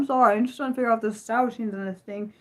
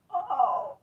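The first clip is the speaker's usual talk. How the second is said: frightened gasp; 7 semitones higher, 6 dB quieter than usual. Real, not acted. Gasps at a creepy picture.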